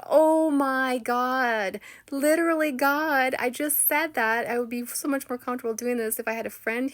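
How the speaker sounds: loud, high, stretched start easing off; retold astonishment at full voice; amazed outburst. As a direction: exclaim it in thrilled disbelief. Acts out her amazed reaction.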